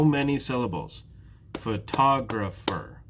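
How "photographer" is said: Photographer slowly in four beats, pho, to, gra, pher; syllable by syllable. Shown as a demonstration. Teacher asking how many syllables, "Photographer" slowly beat by beat. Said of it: "clap it out".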